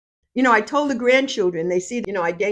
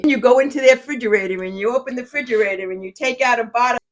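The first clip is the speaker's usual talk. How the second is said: ordinary talk, nothing marked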